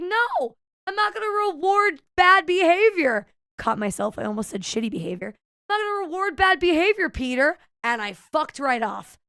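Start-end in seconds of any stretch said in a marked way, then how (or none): 0.0-3.3 high, loud scolding; exaggerated telling-off, punching the words; indignant refusal. Retold refusal to the customer. 5.7-7.6 high, loud, quick telling-off; fast, raised-pitch reprimand, dropping onto the name; indignant scolding. Retold or imitated. Retold scolding, repeated after correction.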